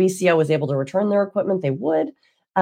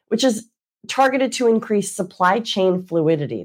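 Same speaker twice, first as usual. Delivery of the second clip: ordinary talk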